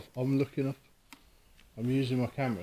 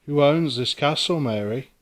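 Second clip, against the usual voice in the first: calling out; 4 dB louder than usual. Real, not acted. Calling out to a spirit.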